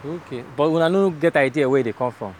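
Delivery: casual Pidgin question; Nigerian Pidgin. Real, not acted. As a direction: ask it in Naija Pidgin. Switches to pidgin with worker.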